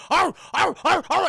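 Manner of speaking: loud, high repeated barks; imitated dog barking. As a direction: bark like an attacking dog. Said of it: "he just starts barking at her".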